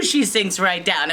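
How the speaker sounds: ordinary delivery, nothing marked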